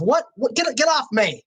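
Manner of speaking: high, startled protest; annoyed outburst. Acted. Crewman to the man who scratched him, startled and annoyed. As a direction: shove him off with the voice. Jostled crewman shoves player away.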